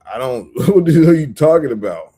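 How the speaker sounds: loud, laughing what the hell; half laugh, half yell in disbelief; exasperated laughing retort. Retold or imitated. Answering the coworker, loud and laughing in disbelief.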